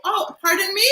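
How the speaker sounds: high, prim mock-Canadian twang, rising at the end; put-on Canadian twang. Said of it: "put on the twang".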